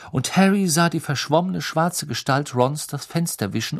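ordinary delivery, nothing marked

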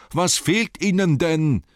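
high, loud, stretched-out question; calling out. A narrator's reading. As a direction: call it across the dark. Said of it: "rief Bilbo".

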